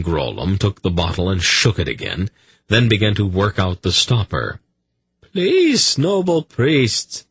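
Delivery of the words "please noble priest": very high, slow, anguished plea. A narrator's reading. Zadi begging the priest to stop, anguished. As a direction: implore in a high, drawn-out voice. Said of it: "Zadi's voice was anguished now".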